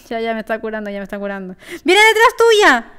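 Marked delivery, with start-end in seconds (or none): 1.8-2.9 loud high warning call; urgent shout. Real, not acted. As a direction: yell to warn your teammate. Warning teammate of enemy.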